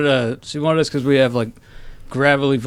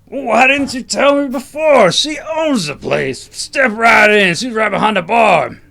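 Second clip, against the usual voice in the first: loud, high, hammed-up biker shout; gruff biker; 5 semitones higher, 4 dB louder than usual. Biker welcoming the owner's friends into the bar, shouted. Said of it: "All bikers sound like that".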